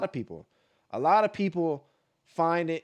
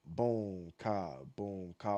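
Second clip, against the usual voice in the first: slow beat demo; 7 semitones lower, 7 dB quieter, much slower than usual. Shown as a demonstration. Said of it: "The way you hear me say it".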